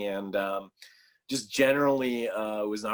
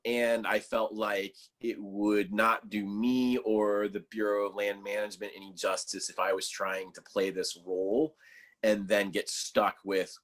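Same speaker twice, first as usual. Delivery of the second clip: ordinary talk, nothing marked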